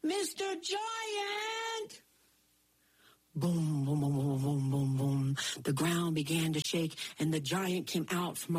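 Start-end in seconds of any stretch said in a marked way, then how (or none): 0.0-2.0 high, slow, drawn-out call; yelling. Acted. Jack calling out to the giant, long high yell. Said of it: "and he yelled". 3.3-5.4 booming footsteps. A narrator's reading. Giant's footsteps approaching.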